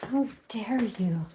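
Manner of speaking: slow, breathy hissed whisper of outrage; mock whisper. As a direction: whisper the offended echo slowly. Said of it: "She's like".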